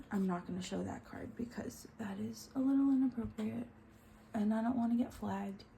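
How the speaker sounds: hushed aside; whispering. Real, not acted. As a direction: whisper it quietly. Hushed aside about inappropriate card.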